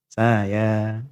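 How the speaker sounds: low joking tease. Retold or imitated. Teasing husband's joke.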